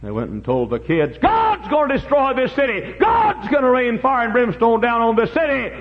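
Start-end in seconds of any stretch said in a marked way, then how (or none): 1.2-5.7 high, loud prophetic alarm; shouted warning. Acted. Father warning his kids of destruction, urgent shout. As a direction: cry out doom at full preaching force.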